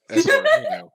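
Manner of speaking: squeaky high voice. Pretend. Ominous joke about BioShock.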